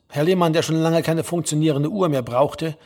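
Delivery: ordinary delivery, nothing marked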